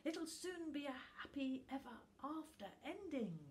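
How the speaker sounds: put-on high childlike voice, contented; little Tuft dreamily tells Book the ending; happy little Tuft. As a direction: sound sleepy-happy and sure. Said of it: "he said happily".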